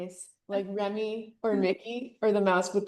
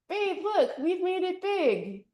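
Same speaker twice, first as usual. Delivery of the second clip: excited; 8 semitones higher than usual. Acted. Mouse excited to girlfriend.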